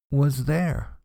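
ordinary delivery

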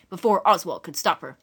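ordinary delivery, nothing marked

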